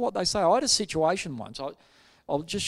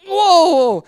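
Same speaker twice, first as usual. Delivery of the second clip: very loud, high whoa; taken aback; 13 semitones higher, 14 dB louder than usual. Retold or imitated. Pastor reacting to a swearing visitor, loud startled whoa. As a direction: let out a booming, high-pitched whoa.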